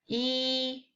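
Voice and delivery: yi held high and level; first tone yi. Shown as a demonstration. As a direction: hold yi high and steady. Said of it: "when you learn it individually".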